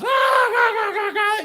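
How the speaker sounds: very high, loud jeering laugh-sounds; hammed-up mocking guffaws; mocking class laughter. Seniors laughing at the locked-out freshman, jeering.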